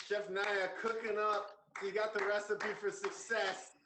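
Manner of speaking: high, drawn-out shout-out; hype announcer. Real, not acted. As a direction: hype her up to the crowd, slow and high. Host hypes the guest to viewers.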